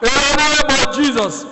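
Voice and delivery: high, earnest cry to God; fervent call for deliverance; pleading prayer. Shown as a demonstration. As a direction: plead with God as if at the end of your strength. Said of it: "Open your mouth, talk to God".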